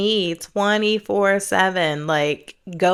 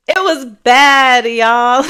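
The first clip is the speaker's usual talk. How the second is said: emphatic; 6 semitones higher, 11 dB louder, much slower than usual. Real, not acted. Emphatic confession to viewers.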